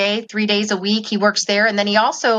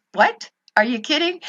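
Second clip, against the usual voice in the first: pitched up high in startled disbelief; imitating her own stunned reaction, higher; 7 semitones higher than usual. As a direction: retell the shock of hearing that call. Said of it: "And I thought".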